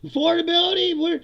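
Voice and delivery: high, loud, laughing mock cheerfulness; mocking the lies. Vlogger mocking Mike Johnson's affordability claim in a high, loud voice. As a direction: parody a fake upbeat spin. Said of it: "repeating those lies".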